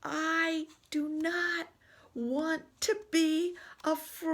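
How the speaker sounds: panicked refusal. Acted. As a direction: wail it out like a scared elephant.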